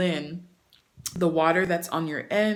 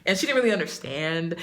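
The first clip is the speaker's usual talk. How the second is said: ordinary delivery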